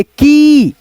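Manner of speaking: की with the vowel held long; stretched long ee. Shown as a demonstration. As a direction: draw की out into "keee". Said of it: "खींच करके पढ़ रहा है".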